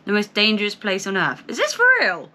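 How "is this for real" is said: high-pitched disbelief; incredulous. Real, not acted. Reacting to a hostile viewer comment, incredulous. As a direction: ask it in high, squeaky astonishment.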